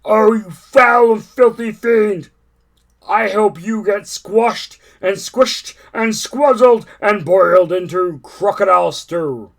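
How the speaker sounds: loud, slow raging elephant curse; furious elephant. Acted. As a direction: spit out the insults at the crocodile. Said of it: "cried Trunky".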